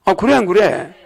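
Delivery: normal talking voice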